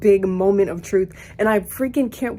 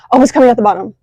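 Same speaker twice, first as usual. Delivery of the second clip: louder, sudden burst of relief; excited exclamation; 11 dB louder, much faster than usual. Real, not acted. Sees backflow smoke finally working, exclaims excitedly. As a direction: blurt it out as the smoke appears.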